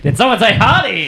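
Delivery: laughing mock Irish lilt; hammed-up Irish accent, laughing. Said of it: "I don't know how to do Irish accents".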